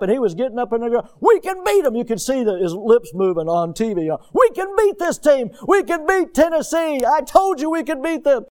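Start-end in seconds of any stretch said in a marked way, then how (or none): 1.2-1.9 high, loud pep-talk shout; excited coach yelling encouragement; coach firing up team. Retold or imitated. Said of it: "he was firing them up". 4.3-8.5 high, loud pep-talk shout; excited coach yelling encouragement; coach firing up team. Retold or imitated. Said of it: "he was firing them up".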